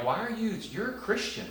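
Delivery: gently puzzled at a scared believer; retold disbelief. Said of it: "And I'm like".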